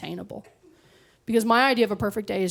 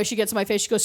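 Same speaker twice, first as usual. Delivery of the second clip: ordinary delivery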